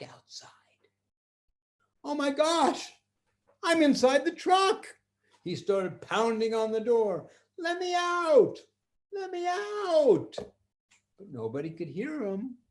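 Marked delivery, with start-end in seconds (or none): 2.0-4.9 put-on high, louder little-boy voice; a small boy suddenly trapped; scared little boy. Trapped boy to himself, high, panicky little voice. 7.6-10.4 high, loud, slow cries to be let out; pleading little boy. Acted. Boy pounding on the truck door, high desperate plea.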